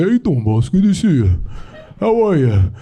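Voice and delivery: mock Italian American big-guy greeting; Italian American uncle. Comic mimics bigger Italian guys, hammed-up Italian American accent. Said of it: "they're just like the bigger guys".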